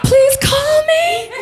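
high, pleading, laughing-crying message; hammed-up tearful plea on an answering machine; desperate voicemail.

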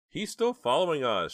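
high, loud panicked cry; frantic high-pitched warning; shrieked alarm. A narrator's reading. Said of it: "shrieked Jade".

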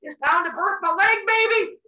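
high-pitched giddy announcement; acting out a thrilled expectant god; excited. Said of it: "So he got excited".

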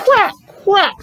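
high, bright quack quack; duck quacking. A narrator's reading. Reading to a child, quacking as the duck. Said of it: "said the duck".

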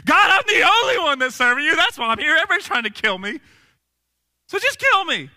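high, loud, hammed-up complaint to God; put-on desperate plea; mock whining prayer. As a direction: wail it out, full of self-pity. Acting out Elijah's repeated prayer.

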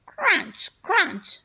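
high-pitched, punchy crunches; crunching sound effect. A narrator's reading. Cornflakes under the possum, high crunchy sound words. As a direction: voice the noise of crunching cereal. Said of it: "went the cornflakes".

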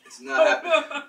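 high squeal through laughter; laughing it out, loud and high. Real, not acted. Repeating refusal while laughing.

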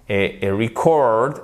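"record" slowly, as re-CORD; stress on second syllable. Shown as a demonstration. Teacher showing learners the verb form, second-syllable stress on "record". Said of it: "l'accent tonique est sur la seconde syllabe".

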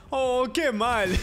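cartoon lament breaking into laughter; Homer. Player recites the Homer meme, laughing lament.

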